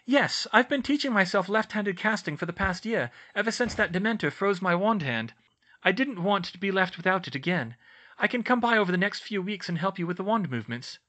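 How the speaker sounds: bright and keen, offering to help; Hermione's voice. A narrator's reading.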